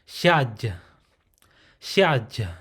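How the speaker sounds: hiaj said slowly with a breathy h and a closing j; closed syllable hiaj. Shown as a demonstration. Teacher demonstrating hiaj for learners, slow and breathy. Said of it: "So it'll be hiaj".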